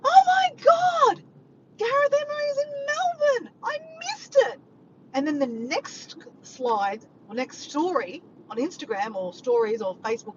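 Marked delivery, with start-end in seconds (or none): 0.0-4.6 high-pitched, loud wail of dismay; re-enacted frantic yell, first words drawn out. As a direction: shriek it to someone in another room. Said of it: "And I'm yelling out to Diego".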